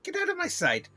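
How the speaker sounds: loud, high, theatrical royal outrage; scornful queen bee. Acted. Queen rejects beekeeper's marigold, scornful and angry. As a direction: scold like an offended queen, building to a dismissal.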